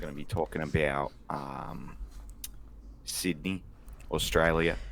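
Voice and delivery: put-on Aussie host, halting; mock Australian, searching for the next topic; Australian accent. Host names tonight's topics, put-on Australian accent. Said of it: "the Australian equivalent".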